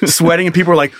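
usual speaking voice

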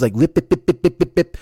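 quick popping buffing noises; imitated shoe-shine noise. Podcaster mimicking shoe shiners at work, imitated buffing noise. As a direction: mimic a rag snapping over a shoe. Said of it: "guys do that with the shoes when they're shining shoes".

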